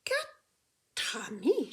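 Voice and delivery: "Quatre amis" slow, the T joined to "amis"; linking T into amis. Shown as a demonstration. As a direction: stretch "Quatre amis" into one word. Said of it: "we have the T R A M I".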